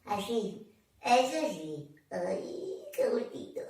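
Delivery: soft, sinking to a whisper; blissful murmur. Pretend. Storyteller enjoying the hand's gentle stroke, blissful hush. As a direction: melt into a hushed, contented sigh of words.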